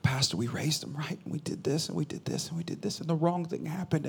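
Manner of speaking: soft, breathy pleading whisper; hushed pleading parent. Retold or imitated. Grieving parent whispering to the pastor, hushed. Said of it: "they're crying".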